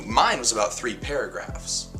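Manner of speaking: plain reading voice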